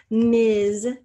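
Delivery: spelling word called out loud and clear. Shown as a demonstration. Teacher calling out a spelling word, loudly. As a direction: announce the word firmly for dictation. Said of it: "capital M".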